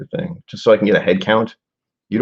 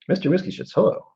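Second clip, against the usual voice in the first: higher, sing-song voice for a pet; pet greeting; 12 semitones higher than usual. Real, not acted. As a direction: coo a hello to the animal that just walked in. Greeting a pet arriving on camera.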